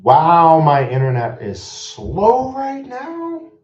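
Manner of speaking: high, drawn-out wail, loud at the start; exasperated complaint. Real, not acted. Streamer fed up with slow internet, high exasperated wail.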